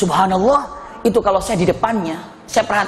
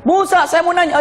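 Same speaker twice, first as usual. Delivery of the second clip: high-pitched, clamouring for attention; pushy mock interruption; noisy questioners; 10 semitones higher than usual. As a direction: imitate a rowdy pupil calling out a question. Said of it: "Bani Israel nih kalau nanya ribut".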